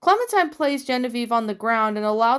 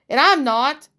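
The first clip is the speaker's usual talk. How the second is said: curt snap, louder; bitter angry retort; snapping; 3 semitones higher, 6 dB louder than usual. A narrator's reading. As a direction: cut in sharply and bitterly. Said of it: "Nick snapped".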